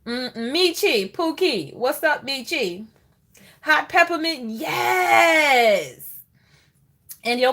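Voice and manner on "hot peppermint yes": high, louder cheer; hyping up a viewer's choice; excited yes. Real, not acted. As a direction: cheer the answer out. Excited shout at viewer comment.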